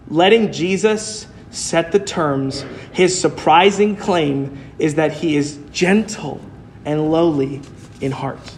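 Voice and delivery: slow and quiet, each word set apart; slow emphatic. Acted. Preacher lingering on Jesus's words to the church. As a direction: lay gentle and lowly down softly, with pauses. Preacher slows for the key phrase.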